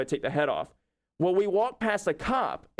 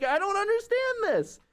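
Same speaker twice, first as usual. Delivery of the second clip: much higher, helpless disbelief; bewildered. Retold or imitated. Fox suiter telling shop owners he is baffled, much higher. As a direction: throw up your hands in confusion. His own bewildered reply.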